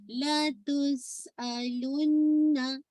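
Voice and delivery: लतुस अलुन्ना slowly, double न held as a hum; complete ghunna. Shown as a demonstration. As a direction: linger through the nose on अलुन्ना. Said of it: "most complete गुन्ना करना है". (लतुस अलुन्ना में न पर पूरा गुन्ना)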